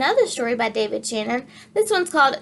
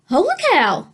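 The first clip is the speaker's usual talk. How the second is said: higher, louder amazed holler; 7 semitones higher, 4 dB louder than usual. Acted. The cook sees the animals pass, loud holler. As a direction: holler across the diner. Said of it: "hollered Manny the cook".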